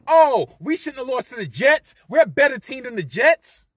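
high loud whiny mimicry of the excuse; mocking quote. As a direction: sneer it like a sore loser. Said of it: "all this shit about".

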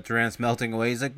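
ordinary talk

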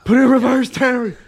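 high, loud put-on holler; mock panicked yell. Joking about backing into a car, mock panicked yell. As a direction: holler it in comic alarm. Hollered joke impression at driver.

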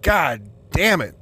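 slow, mock-exasperated groan of a curse. Worn-out partner coming home from work, mock exasperation. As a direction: drag it out in acted dismay. Acted reaction to seeing partner's face.

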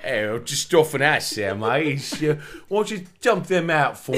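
put-on Peaky Blinders-style English accent; fake English accent. Hustler asking women to flash, in mock English accent. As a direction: play up a bad English accent, amused. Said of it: "an episode of Peaky Blinders".

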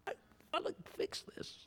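high whispered admission; quiet plea to God; whispered confession. Acted. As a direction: confess it under your breath. Confiding aside to God.

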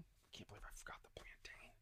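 whispered to himself, annoyed; real whispered self-reproach; whispered aside. Real, not acted. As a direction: breathe it out as a private aside.

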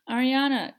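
loud, high desperate cry of the name. Acted. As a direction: scream for her child as the room shakes. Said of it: "Kendra cried out".

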